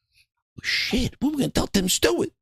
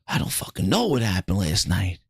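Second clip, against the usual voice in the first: groggy low voice. Acted. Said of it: "It's like".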